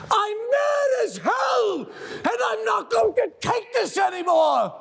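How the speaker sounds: furious rallying yell. Acted. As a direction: yell it out the window. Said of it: "I want you to yell".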